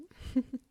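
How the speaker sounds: short amused giggle; small, playful laugh. Acted. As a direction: chuckle lightly to yourself. Amused at having an unexpected visitor.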